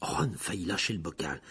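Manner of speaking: ordinary delivery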